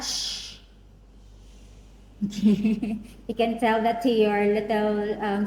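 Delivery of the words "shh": soft long shh; shushing. Shown as a demonstration. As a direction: practise a hushing shh. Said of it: "Let's practice that".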